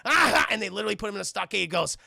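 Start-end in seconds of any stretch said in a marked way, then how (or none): 0.1-0.5 loud, high mock laugh; acted burst of scornful laughter; hammed-up "ah ha"; taunting laugh.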